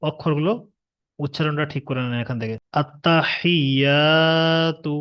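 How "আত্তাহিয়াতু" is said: আত্তাহিয়াতু with the ইয়া stretched one alif long; আত্তাহিয়াতু, ইয়া held long; elongated vowel. Shown as a demonstration. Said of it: "এখানে ইয়ায়ে মাদ্দা আছে".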